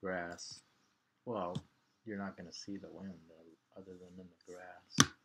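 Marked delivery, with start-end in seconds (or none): none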